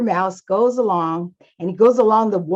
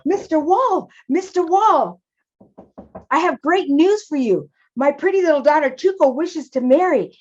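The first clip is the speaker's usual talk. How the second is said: high-pitched, thrilled storybook mouse; Father Mouse, excited; 6 semitones higher than usual. Acted. Father Mouse brings Mister Wall great news, excited and high.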